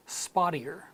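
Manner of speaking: spottier with a D-like T and final R; American flap T. Shown as a demonstration. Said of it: "in American English".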